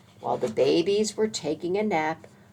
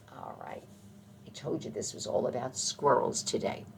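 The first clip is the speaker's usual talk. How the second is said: usual speaking voice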